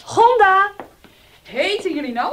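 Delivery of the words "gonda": loud call across the house. Acted. Mother calling Gonda loudly through the house. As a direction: call her name loud.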